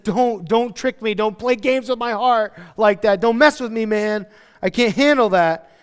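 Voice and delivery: acted-out woman warning him off; pleading protest. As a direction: plead slowly and forcefully. Acts woman pleading not to be misled.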